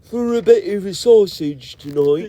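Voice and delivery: high, slow, exaggerated British accent; mock British. Joining the sausage bit, in high mock British. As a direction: draw it out in silly British. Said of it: "speak British now".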